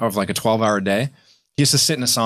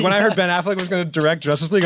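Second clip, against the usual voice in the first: usual speaking voice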